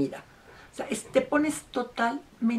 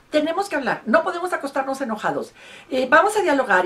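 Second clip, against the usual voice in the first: higher, louder pleading to talk; insistent pleading wife; 7 semitones higher, 7 dB louder, faster than usual. Retold or imitated. Wife chasing husband room to room, insistent loud pleading. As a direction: chase him down demanding a talk, raised voice. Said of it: "perseguía a su marido".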